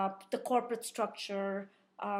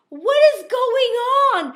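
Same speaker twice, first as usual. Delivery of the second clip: very high, loud what is going on; disbelieving outcry; astonished; 11 semitones higher, 15 dB louder than usual. Retold or imitated. Guide amazed at the surprise call. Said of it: "he's like".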